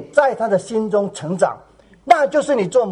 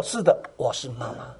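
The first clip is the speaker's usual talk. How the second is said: tender soft reply; 9 semitones lower, 8 dB quieter than usual. Pretend. Gentle mother answering crying girl.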